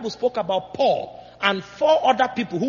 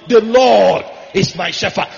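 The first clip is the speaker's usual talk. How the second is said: slow and much louder, declared outright; loud proclamation; 9 dB louder than usual. Pretend. Preacher voicing David's psalm to God, proclaimed loudly and slowly. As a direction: proclaim it like a king bowing to God.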